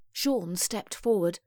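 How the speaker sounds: ordinary delivery, nothing marked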